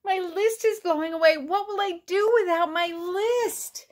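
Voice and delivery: high-pitched storybook distress; panicked cry. A narrator's reading. Toad watching the list blow away, distressed cry. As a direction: wail it in rising panic. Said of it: "cried Toad".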